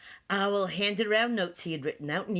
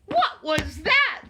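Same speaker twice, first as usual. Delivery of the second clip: high, loud, slow alarmed question; startled; 11 semitones higher, 8 dB louder than usual. A narrator's reading. Friends hearing another knock, loudly startled.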